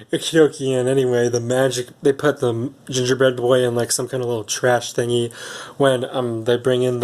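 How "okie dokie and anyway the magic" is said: ordinary delivery, nothing marked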